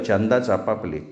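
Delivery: ordinary delivery, nothing marked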